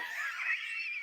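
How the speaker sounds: very high squeaking laugh; squeaky breathless laughing fit; helpless laughter. Real, not acted. Laughing at Christmas snow trooper.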